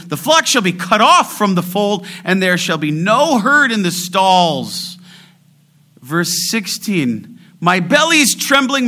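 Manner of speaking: high, loud declamation of scripture; forceful scripture preaching. Acted. Preacher reciting rejoicing despite trembling and loss, high and forceful. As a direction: proclaim the verses with mounting pulpit force.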